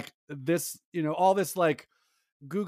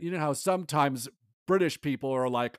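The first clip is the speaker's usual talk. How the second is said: normal talking voice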